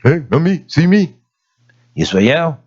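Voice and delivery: ordinary delivery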